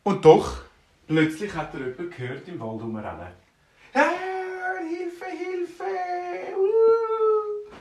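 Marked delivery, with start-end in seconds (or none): 3.9-7.7 high-pitched wailing for help; panicked cry for help. Acted. Panicked baker runs about screaming.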